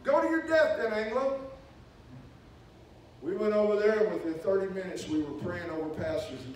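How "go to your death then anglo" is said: mock guard taunt. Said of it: "slapping his knee and laughing".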